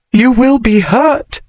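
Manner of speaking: cried out. A narrator's reading. Woman warning her lover, crying out, anguished. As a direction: cry out in fear for him. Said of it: "she cried".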